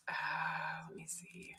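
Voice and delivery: whispered to self; hushed murmur while searching; whispering. Real, not acted. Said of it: "muttering to herself searching".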